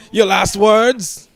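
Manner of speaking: drawn-out loud mock threat; mock menacing. Gamer voicing the gunman's threat, mock menacing. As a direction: play the taunting executioner, stretching it out.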